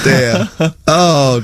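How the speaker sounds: ordinary talk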